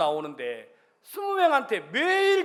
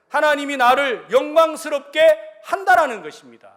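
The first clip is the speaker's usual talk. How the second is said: loud, rising preacher's proclamation; fervent preaching; 5 semitones higher, 9 dB louder than usual. Real, not acted. Pastor preaches to the congregation, loud fervent proclamation. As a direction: proclaim God's word to the congregation.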